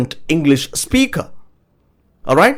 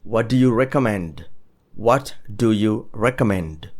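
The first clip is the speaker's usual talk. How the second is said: repeat-after-me model; 3 semitones lower, 5 dB quieter than usual. Shown as a demonstration. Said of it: "You wanna repeat it after me?".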